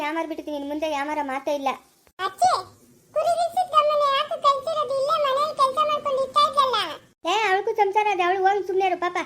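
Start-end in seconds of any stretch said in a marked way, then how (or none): 2.3-6.8 put-on child voice. Little girl asks why Siddamma was sent away, high whine.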